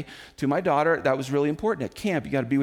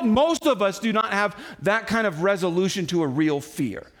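ordinary talk, nothing marked

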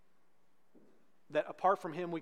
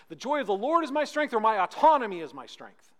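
preached refrain; 5 semitones higher, 10 dB louder than usual. Acted.

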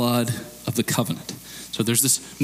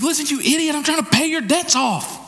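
much higher, exasperated pleading rebuke; getting angry; 13 semitones higher than usual. Pretend. Pastor frustrated at a friend refusing debt payment, exasperated high rebuke. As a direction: shout at someone refusing a gift. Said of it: "I'm gonna get angry with you".

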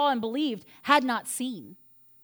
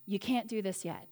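lower, gentle Jesus voice; steady and kind, then fading on "Mary"; a lightly voiced Jesus telling Mary to wait; Jesus speaking; 6 semitones lower than usual. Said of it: "And Jesus says".

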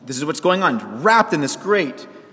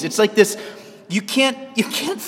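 ordinary talk, nothing marked

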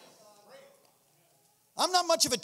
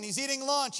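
ordinary talk, nothing marked

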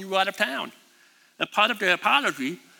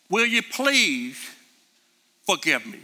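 sincere plea. Shown as a demonstration. Preacher models asking a spouse's forgiveness, sincere. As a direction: ask it humbly and slowly. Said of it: "much more powerful".

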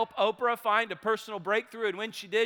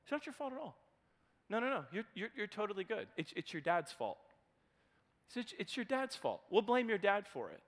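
much softer, tender and soothing; soft reassurance; 11 dB quieter, faster than usual. Retold or imitated. Therapist soothing the big man, tender and soft. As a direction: comfort him like a scared child. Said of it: "And Wayne says to him".

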